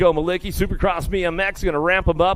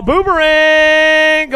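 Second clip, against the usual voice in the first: higher, louder, slow stretched call; drawn-out call; 9 semitones higher, 7 dB louder, much slower than usual. Acted. Announcer hyping the leader, stretched excited call. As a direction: stretch the name out like a big race call.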